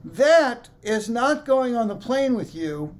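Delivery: loud, slow, high announcement across the room. Retold or imitated. Gate agent calling to a passenger, loud and slow. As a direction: bark it out slow so the whole gate hears. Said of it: "she says to me in a loud voice".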